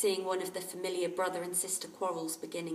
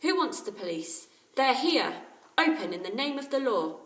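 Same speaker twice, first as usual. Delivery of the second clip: louder, booming official call; 5 semitones higher, 8 dB louder than usual. A narrator's reading. Bill at the bedroom door pretending to be police, booming. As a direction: boom it like a policeman. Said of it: "A loud voice came through the door".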